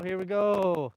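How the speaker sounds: ordinary delivery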